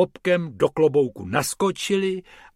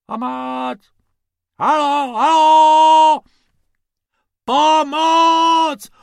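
calling for help; 10 dB louder, much slower than usual. Acted. Calls for help from desert.

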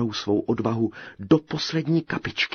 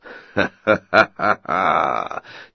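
booming giant's belly laugh; long, loud, low mocking laughter; huge laugh; 3 semitones lower, 7 dB louder than usual. A narrator's reading. Giant spots the tiny tailor, huge booming laugh. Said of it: "dal se do velikého smíchu".

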